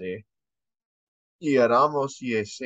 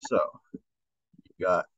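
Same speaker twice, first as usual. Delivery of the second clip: usual speaking voice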